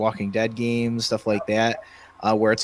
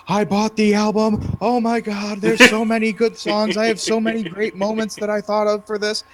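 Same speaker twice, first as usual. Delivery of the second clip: quoting him much higher and gushing; bubbly retelling of his thrilled reply; excited Rob; 10 semitones higher, 4 dB louder than usual. Retold or imitated. Friend gushing about the album he bought, high and excited. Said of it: "the next day he's like".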